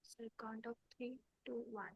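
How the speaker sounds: faint, barely audible voice; speaking far too softly; too quiet. Real, not acted. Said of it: "Your voice is very low".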